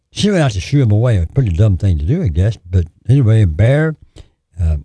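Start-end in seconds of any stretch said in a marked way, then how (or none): none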